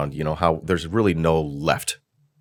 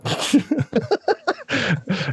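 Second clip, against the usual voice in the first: high laugh. Real, not acted. Laughs at grand entrance.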